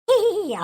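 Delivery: a quick amused burst of laughter; short high laugh. Acted. Brother laughing at his anxious sister, quick laugh. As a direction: laugh off her worry.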